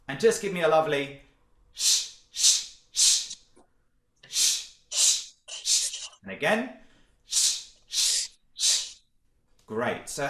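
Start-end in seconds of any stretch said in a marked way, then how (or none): 1.7-6.1 unvoiced bursts of shh; punchy little puffs of shushing air; short shushes. Shown as a demonstration. Coach has class feel the belly bounce, short sharp shushes. Breath exercise demonstrated for class. 7.2-8.9 punched voiceless shh bursts; little puffs of shushing air; short shushes. Shown as a demonstration. Coach repeats the belly exercise, short sharp shushes.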